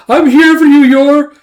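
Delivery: very high, loud gleeful call; exuberant entrance. Acted. Brother barging in on his sister, exuberant. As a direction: burst in shouting a greeting. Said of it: "as Yuri barges in".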